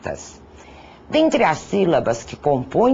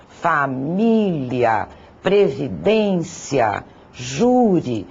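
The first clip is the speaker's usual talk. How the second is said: slowly stretched fa-MÍ-lia, previ-DÊN-cia, JÚ-ri; slow list stressing each next-to-last syllable; stress on penultimate syllables; much slower than usual. Shown as a demonstration. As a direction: draw out "família, previdência, júri", leaning on the stressed syllable. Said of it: "recai na penúltima sílaba".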